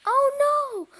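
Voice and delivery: high, loud, drawn-out cry; piercing wail of alarm; terrified scream. A narrator's reading. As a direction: scream in storybook horror. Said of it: "screamed Fluffy".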